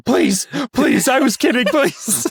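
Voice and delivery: high-pitched imitation of panicked bargaining; mock begging. Little brother bargains before the punch, mock begging. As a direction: plead in a high kid's voice. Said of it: "Stanley tries to bargain".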